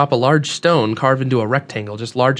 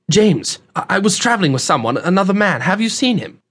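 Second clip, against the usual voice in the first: put-on higher, urgent young man's voice; worried young traveler; 7 semitones higher than usual. Young man asks host about his missing companion, worried. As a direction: stumble anxiously into the question, pitched up.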